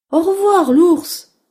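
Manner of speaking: high, loud mouse farewell; cheerful little mouse. Acted. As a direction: chirp goodbye as a mouse. Mouse calls goodbye to bear. (au revoir aigu de petite souris)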